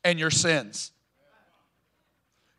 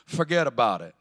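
put-on New York accent; 8 semitones lower than usual. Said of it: "I always hear New York Jesus that says".

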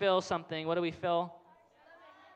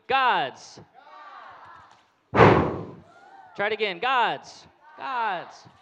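loud cue word; 3 semitones higher, 6 dB louder, much slower than usual. Shown as a demonstration. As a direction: call God's out loud. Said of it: "repeat after me".

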